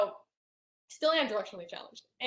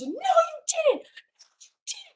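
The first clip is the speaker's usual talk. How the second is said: much higher, drawn-out mock shriek; screaming aunt; 7 dB louder, much slower than usual. Aunt denying the abuse in the car, shrieking. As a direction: mimic her shrill denial. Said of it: "like a mad woman, screaming off the top of her lungs".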